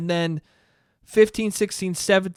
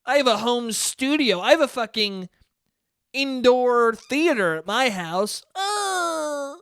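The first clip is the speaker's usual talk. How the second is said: high, snooty mock voice of a rich kid; snobby rich friend; 7 semitones higher, slower than usual. Mocking a rich friend who sneers at the playroom, high and snooty. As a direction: look down your nose at the playroom. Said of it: "friends who were like".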